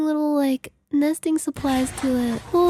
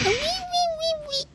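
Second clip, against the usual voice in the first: tiny high squeaky cries; cat imitation; 12 semitones higher, 7 dB quieter, much faster than usual. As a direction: mimic a small cat, high and soft. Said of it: "the cat that goes".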